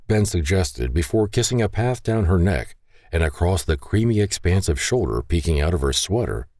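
ordinary delivery

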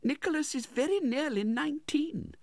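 very high, womanly character voice; anxious widowed mother. A narrator's reading. Widow answers her brother-in-law about her son, meek.

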